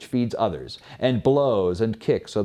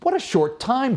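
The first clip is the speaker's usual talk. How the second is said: higher dismayed cry; the man's startled higher outcry; 11 semitones higher than usual. Acted. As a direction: exclaim in disbelief at how little. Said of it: "cried man".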